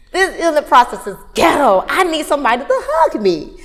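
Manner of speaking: hammed-up plea for comfort; exasperated outburst. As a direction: cry out in laughing exasperation.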